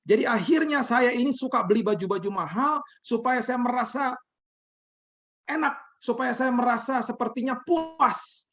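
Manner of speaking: imitating a high, wailing grievance; pleading high-pitched mock lament; tearful outburst. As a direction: pour out the hurt in a high acted cry. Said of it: "dengan tangisan dia mulai berteriak".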